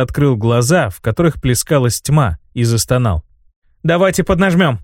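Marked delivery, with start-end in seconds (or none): 3.8-4.8 shouting. A narrator's reading. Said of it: "крикнула Шейла".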